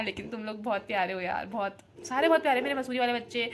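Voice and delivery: usual speaking voice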